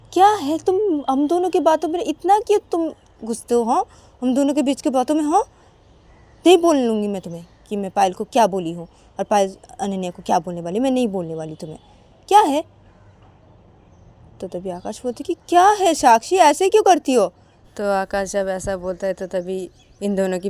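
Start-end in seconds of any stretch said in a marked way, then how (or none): 0.1-12.6 higher, louder angry scolding wife; wife scolds her husband for meddling, angry. Acted. Sakshi scolding Akash in quarrel. 15.5-17.3 higher and louder, fed up; husband protests to his wife, exasperated and loud. Acted. Akash complains in quarrel.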